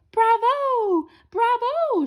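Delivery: high, loud cheering shout; shouting bravo. A narrator's reading. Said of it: "shouted the big boss".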